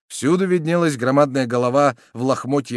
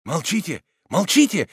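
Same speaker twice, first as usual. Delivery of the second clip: crowd calls; 7 semitones higher than usual. A narrator's reading. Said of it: "раздалось в толпе".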